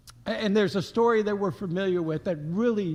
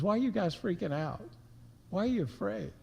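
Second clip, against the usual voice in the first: softer, fast, gentle asking; gentle Jesus; 8 dB quieter, faster than usual. Retold or imitated. Jesus gently asking the scared disciples, softer. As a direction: gently ask why they are afraid. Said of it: "He says".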